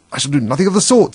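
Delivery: loud, high, huffy refusal; cross and affronted; offended. A narrator's reading. Offended mouse storming off.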